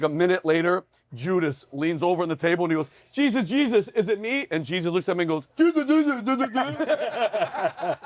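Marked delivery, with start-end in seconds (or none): 3.1-4.5 higher-pitched worried question; feigned nervous pleading, pitched up; mock anxious Judas. Judas leans over to ask Jesus, mock anxious. 5.6-8.0 high mocking mimicry, cracking up; mock the question back in a high voice; fed-up Jesus. Jesus answers Judas at the table, mockingly fed up. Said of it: "he was so fed up".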